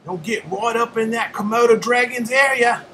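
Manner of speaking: put-on high, loud Aussie accent shout; Australian croc hunter calling out a warning. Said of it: "Aussie accent".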